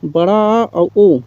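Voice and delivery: बड़ा आ और ओ said slowly, an open ऑ between आ and ओ; the sound between aa and o. Shown as a demonstration. Teacher to students, naming the ऑ sound slowly. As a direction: stretch out the vowel of बड़ा आ और ओ toward an open aw. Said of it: "के बीच की ध्वनि कौन सी है?".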